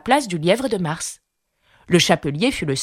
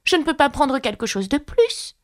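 ton offensé; 8 semitones higher than usual. A narrator's reading. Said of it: "d'un ton offensé".

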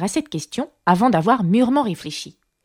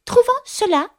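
high, grumpy mouse voice; sullen mouse. A narrator's reading. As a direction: repeat it testily, pitched right up. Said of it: "répondit la souris d'un ton plutôt maussade".

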